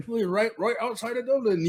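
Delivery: a put-on Irish accent, high and lilting; playing the Irishman in a mock Irish accent. A real Irish guy answering in a high, mock Irish accent. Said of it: "Irish accent".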